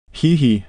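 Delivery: high, loud gleeful "hee hee"; mischievous high giggle; delighted giggle. A narrator's reading. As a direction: giggle with sly delight at corrupting a child. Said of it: "said Mister Raymond, evidently taking delight".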